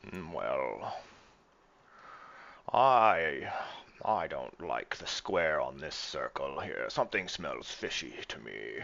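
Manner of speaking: put-on slow, suspicious drunken captain; Greybeard, drunken. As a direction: mull it over slowly like a tipsy, wary captain. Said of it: "do my best drunken Greybeard voice".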